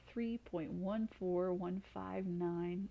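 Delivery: slow, each digit spaced out; slow digit reading. Shown as a demonstration. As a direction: read the digits carefully one by one.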